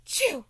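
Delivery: a loud burst of achoo; loud sneeze. Acted. Three hidden kids sneeze together, loud. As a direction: sneeze it out big. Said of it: "all at the same time go".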